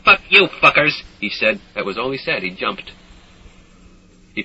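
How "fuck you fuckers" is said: defiant curse. A narrator's reading. Stink's parting curse.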